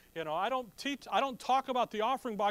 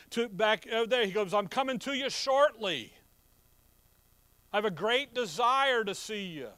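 loud, high, urgent sermon delivery; fiery preaching; 5 semitones higher, 7 dB louder than usual. Acted. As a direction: preach it to the back row. Preaching at full force.

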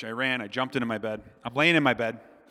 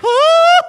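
a piercing high scream; a kid's shriek of terror, very high and loud; 18 dB louder than usual. Retold or imitated. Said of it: "I screamed".

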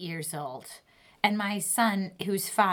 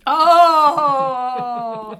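loud, delighted burst; excited; 6 semitones higher, 14 dB louder than usual. Acted. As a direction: shout it out with glee. Excited at the game.

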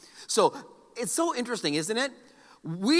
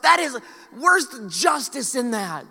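indignant; 5 semitones higher, 3 dB louder, slower than usual. Retold or imitated. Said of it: "And I thought to myself".

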